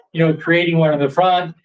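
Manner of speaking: ordinary delivery, nothing marked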